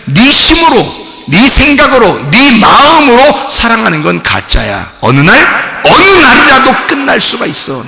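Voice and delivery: high, loud preaching cry; fiery preaching. Acted. Pastor preaching to the congregation, fiery and loud. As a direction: preach it out with force.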